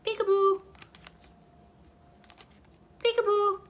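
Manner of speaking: high-pitched little peekaboo call; playful peekaboo. Pretend. Peeking through the bookmarks at viewers, playful high call. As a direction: pipe it up high, like a game with a baby.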